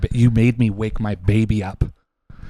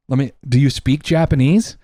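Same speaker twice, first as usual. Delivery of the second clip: usual speaking voice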